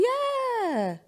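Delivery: high, drawn-out gushing mock approval; enthusiastic mom. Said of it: "Her mom would be like".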